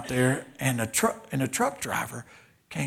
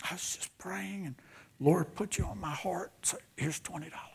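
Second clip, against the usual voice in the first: tender whispered confiding; soft whisper; 5 semitones higher, 6 dB quieter than usual. Retold or imitated. Sunday school teacher telling the preacher she prayed, whispered. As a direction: hush it gently, like sharing a secret blessing.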